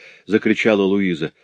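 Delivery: plain reading voice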